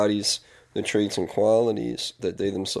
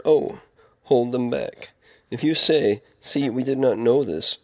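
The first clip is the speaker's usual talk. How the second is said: firm, raised reading voice with emphatic key words; scripture reading; 3 semitones higher, 4 dB louder than usual. Acted. Man reading Proverbs aloud to his listeners, firm scripture reading. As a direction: proclaim the verses in measured phrases.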